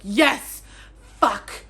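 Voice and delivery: loud, punchy exclamations; emphatic reenacted reader's reaction; retold excited outburst. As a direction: act out cheering on the book. Acts out reactions while annotating.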